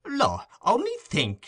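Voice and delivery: high, slow exclaiming; flustered hostess. A narrator's reading. Mrs Mann running out to the gate, high and flustered. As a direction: gasp it out in mock dismay. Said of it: "said Missus Mann".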